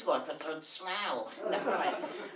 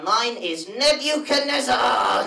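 proud shout; 7 semitones higher, 11 dB louder, slower than usual. Acted. Dummy shouts its name.

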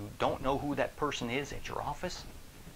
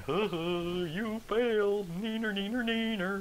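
high, slow sing-song neener neener; childish taunt; 10 semitones higher, 4 dB louder, slower than usual. Retold or imitated. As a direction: taunt like a playground bully. Coworker taunting failure.

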